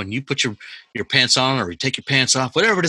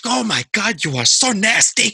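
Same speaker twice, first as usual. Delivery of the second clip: mock shocked mom; 10 semitones higher, 3 dB louder, slower than usual. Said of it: "She goes".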